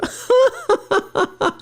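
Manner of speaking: sudden high-pitched laugh; real laugh, high and bright; high burst of laughter. Real, not acted. Laughing at a chat comment.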